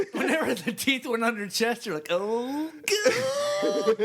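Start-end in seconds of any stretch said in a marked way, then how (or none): 2.1-4.0 rising, breaking mock ecstatic moan. Mocking his friend's reaction, a hammed-up moan. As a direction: sigh it out like hammed-up pleasure.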